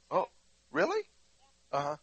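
ordinary talk

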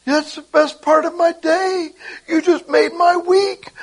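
high, loud, tearful gushing thanks; tearful gushing person. Retold or imitated. A grateful stranger thanks the preacher, high and tearful. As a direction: pour out thanks, voice high and breaking. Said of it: "Somebody's going".

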